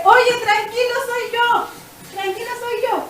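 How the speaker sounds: high-pitched call over a storm; retold calling out. Jesus calling to disciples in the storm, mock shout. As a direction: call out reassurance, imitating a shout. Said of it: "le tuvo que haber gritado".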